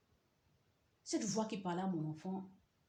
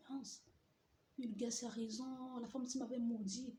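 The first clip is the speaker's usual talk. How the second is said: hushed; 6 semitones higher, 4 dB quieter than usual. Retold or imitated. Inner thought, stunned.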